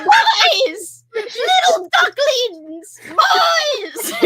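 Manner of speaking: high shouted duck squawk, laughing; screeching cartoon duck calling his boys; Donald Duck yelling. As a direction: holler for the ducklings like Donald. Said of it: "You know how his three sons?".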